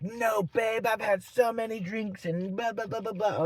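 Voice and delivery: high-pitched imitation trailing into blah-blah; mocked drunk woman. Mocking the drunk woman's reply.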